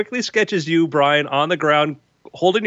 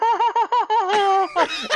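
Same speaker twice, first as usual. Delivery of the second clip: high, airy giggle; foppish laugh. Acted. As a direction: titter like a fop. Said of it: "does this foppish laugh".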